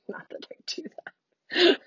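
high, slow whisper of a guilty denial; whispered aside. Real, not acted. Podcaster whispers a joking denial about watching TikTok videos. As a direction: confide it in a hush, tongue in cheek.

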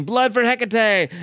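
hammed-up battle yell of a vampire. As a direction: charge on horseback yelling it. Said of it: "and he's yelling".

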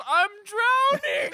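very high, drawn-out comic wail; mock drowning cry. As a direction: imitate a panicked cry for rescue, stretched out. Acting drowning woman in pool.

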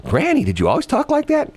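a grandkid asks Granny about her language. Retold or imitated. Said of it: "the kid would say".

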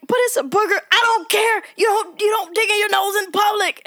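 high mock mom yelling at her kid; exasperated mom. Mom losing patience with nose-picking boy, high mock yell. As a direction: pitch it up and bark the rules. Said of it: "And then he was like".

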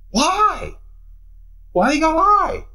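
high, pleading cry of why; high exasperated why, preacher to congregation. Acted. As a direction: throw the question up high in disbelief.

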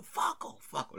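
high, strained mock yell; imitated drunken screaming. Friend acting the drunk guy telling him off, strained mock yell. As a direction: act out a scream without full volume. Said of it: "he would just be screaming".

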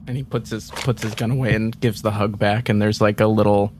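ordinary delivery

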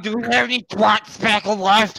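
high, put-on little-kid voice asking mom; childish mock kid voice. Co-host calling to mom in a high mock kid voice.

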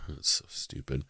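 whispered scoff under the breath; muttered aside. Real, not acted. Host scoffing to himself, whispered disgust. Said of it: "host scoffing at the list".

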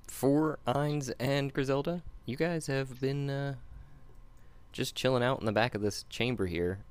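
steady scene-setting narrator voice; game master narration. Acted. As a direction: set the scene for the players. GM sets the scene.

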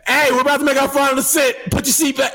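high, loud, fast shouted order; screaming flight attendant. Retold or imitated. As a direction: yell the landing order at the cabin. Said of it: "screaming at me".

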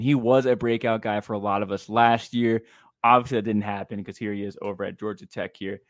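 ordinary delivery

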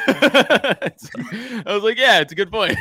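high, loud laughing through the words. Real, not acted. Friend retells Vegas story to buddies, laughing hard.

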